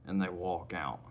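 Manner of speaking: usual speaking voice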